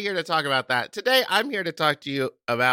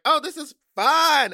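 tipsy; 10 semitones higher, slower than usual. Retold or imitated. Retold tipsy self.

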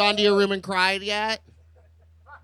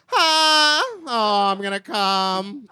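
mock climax sounds; much slower than usual. Answering what sounds they make, mock ecstatic moan. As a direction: ham up a drawn-out climax. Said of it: "What kind of sounds you make?".

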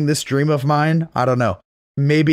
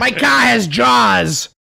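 drawn-out, higher Boston menace; slow Boston accent; 8 semitones higher, 4 dB louder, much slower than usual. Retold or imitated. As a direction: savour each word, Boston thug. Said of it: "great Boston accent".

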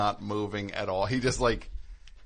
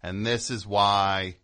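slow, louder, deliberate; mock rallying cry; 4 dB louder, slower than usual. Mocking Hollywood, a slow, louder mock battle cry. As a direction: spell it out slowly like a rebel leader. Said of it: "slow rallying call".

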